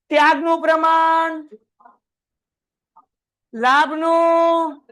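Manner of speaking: loud, slow, high call to recite; loud drill call. Real, not acted. Teacher drilling the class on formulas, loud and slow. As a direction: draw it out to make them answer.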